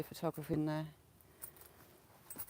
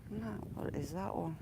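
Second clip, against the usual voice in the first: ordinary talk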